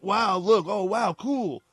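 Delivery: high-pitched pretend amazement; mock impressed onlookers. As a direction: act out people being wowed. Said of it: "like, impress the people".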